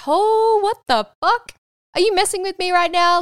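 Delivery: high-pitched, incredulous outburst; retold shocked disbelief. Replaying her reply to sister's news about dad, shocked disbelief. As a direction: act out stunned disbelief, pitch jumping up.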